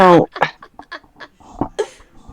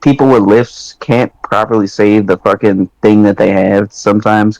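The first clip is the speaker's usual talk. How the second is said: usual speaking voice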